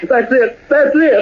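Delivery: rising, high and thrilled, cries it out; giddy gold-rush cry; overjoyed. Acted. Gold strike, overjoyed shout.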